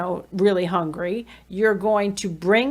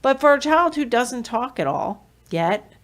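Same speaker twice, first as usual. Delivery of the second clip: normal talking voice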